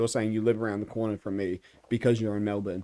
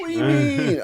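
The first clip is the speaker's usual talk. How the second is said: high, put-upon what do you mean; playfully outraged at being called British; mock indignant; 5 dB louder than usual. Mock offended again.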